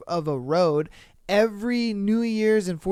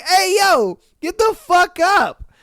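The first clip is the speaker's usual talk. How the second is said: much higher, louder mock yell; retold yell; 10 semitones higher, 7 dB louder than usual. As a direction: bark them awake like an angry host. Homeowners yelling at the squatters.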